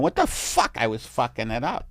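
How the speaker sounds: normal talking voice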